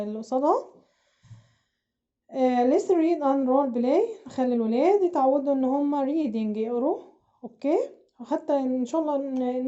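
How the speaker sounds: normal talking voice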